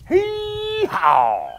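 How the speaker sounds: high, stretched donkey bray. Acted. Reader voices the donkey's bray.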